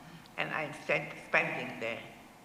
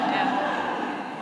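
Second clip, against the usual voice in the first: laughing; 4 semitones higher, 6 dB louder than usual. Real, not acted. Laughing with the audience.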